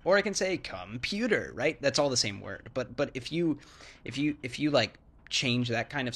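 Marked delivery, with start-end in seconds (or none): 0.0-1.5 computer said on a higher pitch. Shown as a demonstration. Showing English intonation does not matter, higher. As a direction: lift the whole word computer up high.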